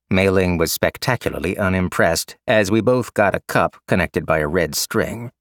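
usual speaking voice

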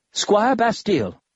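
slow, stern high-pitched snap; snapped. Acted. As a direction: bark her title out sharply. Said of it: "Drowlin snapped".

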